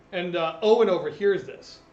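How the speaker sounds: ordinary delivery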